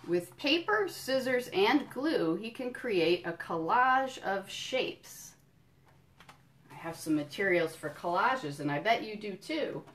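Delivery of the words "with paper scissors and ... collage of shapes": slow, lilting picture-book read; reading to children. Acted. Museum educator reading a picture book to kids, storytelling. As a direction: savour each item for little listeners.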